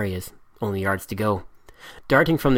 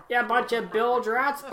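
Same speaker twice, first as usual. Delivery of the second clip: much higher, giggling mock insult; laughing mimicry; 12 semitones higher, 5 dB quieter, slower than usual. A narrator's reading. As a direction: parrot the captain while laughing.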